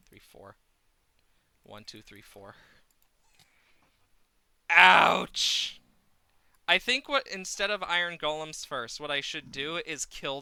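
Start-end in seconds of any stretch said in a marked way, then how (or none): 0.1-2.6 whispered count. Real, not acted. Counting under breath. 4.7-5.8 shouted ouch. Real, not acted. Hit again, shout.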